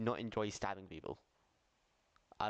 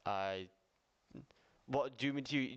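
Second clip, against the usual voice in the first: usual speaking voice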